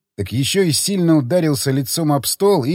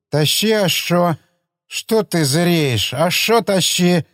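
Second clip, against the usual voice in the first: put-on slow, higher-pitched slurring drunk; drunken slur; 4 semitones higher, slower than usual. Drunk man pestering for another bottle, slow drunken slur. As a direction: drag the words out drunkenly. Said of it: "На пьяном, на пьяном языке".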